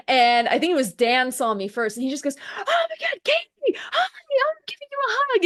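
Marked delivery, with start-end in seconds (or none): 2.6-5.4 much higher, overjoyed greeting breaking into a happy shout; excited squeal. Retold or imitated. Dan spotting Katie in the hallway, high excited shout. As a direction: squeal hello like spotting a long-lost friend.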